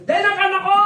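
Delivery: high, loud proud yell; loud triumphant shout. Retold or imitated. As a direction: shout it out to the whole wake. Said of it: "May sumigaw dun".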